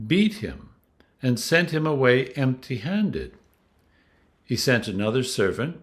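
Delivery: plain reading voice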